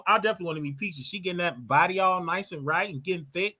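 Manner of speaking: ordinary delivery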